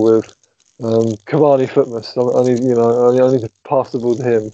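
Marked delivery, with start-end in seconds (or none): none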